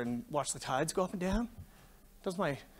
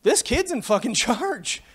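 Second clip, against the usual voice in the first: slow and higher, breaking into a laugh; retold punchline dissolving into laughter; laughing through it; 9 semitones higher, 4 dB louder, slower than usual. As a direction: savor the boss's comeback while cracking up. Boss's retort, speaker laughing.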